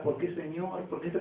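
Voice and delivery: soft, hushed plea to God; subdued questioning. Acted. Believer questioning the Lord in a storm, subdued. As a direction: ask it quietly, as a troubled believer would.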